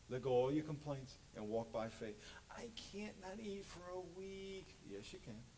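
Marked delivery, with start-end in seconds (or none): 2.5-4.8 higher, slow mock whine; soft high mock complaint; whiny excuse. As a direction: whine like a reluctant churchgoer. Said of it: "mock excuse".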